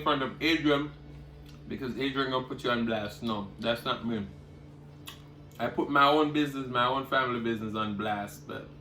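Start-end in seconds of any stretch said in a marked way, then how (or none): none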